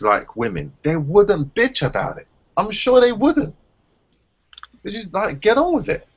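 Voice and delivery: scolding the ex for wanting pity; exasperated rant. Real, not acted. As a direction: rant at her in disbelief. Ranting at the girlfriend.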